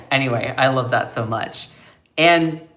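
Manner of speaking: ordinary delivery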